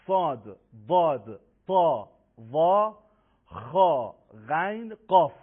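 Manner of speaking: each letter said loud, full and heavy; loud, separated heavy Arabic letters; heavy articulation. Shown as a demonstration. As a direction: raise the back of the tongue, thicken each letter.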